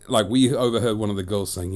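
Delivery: usual speaking voice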